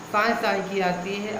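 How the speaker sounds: normal talking voice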